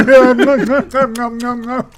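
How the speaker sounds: munch it out greedily, giggling; mock eating noises. Friend imitates the fat dad eating, laughing munch sounds.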